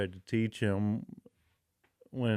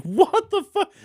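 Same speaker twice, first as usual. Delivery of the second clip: squeaky high mock shock, louder; imitating amazed disbelief; 6 dB louder than usual. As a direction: blurt it out in total disbelief. Imitating amazed Muggle character.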